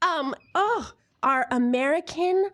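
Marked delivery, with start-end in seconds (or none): none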